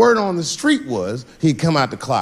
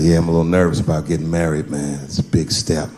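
friend voice; 10 semitones lower than usual. Retold or imitated.

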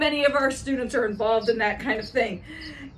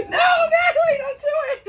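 laughing plea; 11 semitones higher than usual. Real, not acted. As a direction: beg her to stop, half laughing. Pleading over talking colleague.

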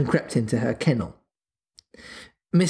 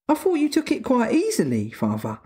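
put-on puzzled little girl; 11 semitones higher than usual. A narrator's reading. Puzzled Wendy questions her father.

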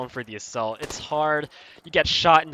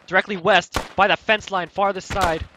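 higher, louder sniper warning; urgent callout; 5 semitones higher, 5 dB louder than usual. Real, not acted. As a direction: shout the warning to the squad.

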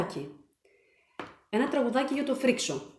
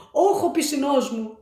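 higher, louder pained complaint; stung diver; 3 semitones higher, 5 dB louder than usual. A narrator's reading. The stung diver complains loudly of the pain. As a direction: yelp from the spikes.

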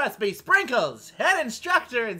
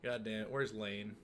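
ordinary talk

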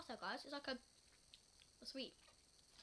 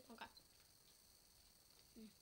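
ordinary talk

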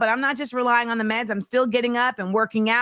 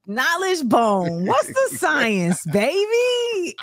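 slow, drawn-out mock rapper catchphrase; hammed-up slang hype; 6 semitones higher, much slower than usual. Host trading rapper slang for laughs, hammed-up hype. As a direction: stretch each word, swooping high at the end. Joking along with Wu-Tang slang.

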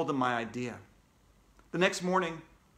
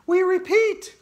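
very high, loud announcer; Andrea, urgent newsreader; 9 dB louder, slower than usual. Acted. Andrea repeating the newsflash, high urgent announcer.

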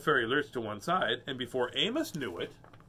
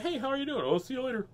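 very high cartoon greeting; high-pitched storybook dog voice; chirpy dog character; 12 semitones higher, faster than usual. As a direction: squeak a quick friendly hello and goodbye. Acts out Amos greeting visitor.

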